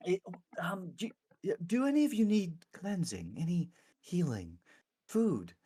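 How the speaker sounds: high-pitched, halting cleric; eager, hesitant clergy member. Acted. Clergy member offers food and healing.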